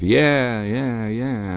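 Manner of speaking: lightly called-out cheering; mild cheering cry. A narrator's reading. Distant crowd as the dancing begins, mild cries of cheer. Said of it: "intermittent cries of".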